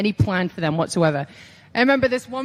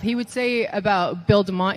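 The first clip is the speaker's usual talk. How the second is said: ordinary talk, nothing marked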